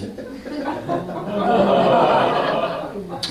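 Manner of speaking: airy high laugh at his own joke; breathy laugh. Real, not acted. Comic laughing at his own punchline, breathy laugh.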